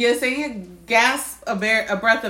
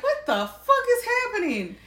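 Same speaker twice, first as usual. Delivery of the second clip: confused; 11 semitones higher than usual. Retold or imitated. Confused hearing cackling.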